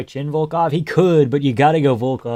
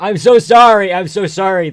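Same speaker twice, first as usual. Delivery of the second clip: loud, higher, hammed-up apology; mock sorry; 8 semitones higher, 11 dB louder than usual. Streamer mock-apologizes to the viewer, loud and hammed-up. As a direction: overplay the sorry, loud and high.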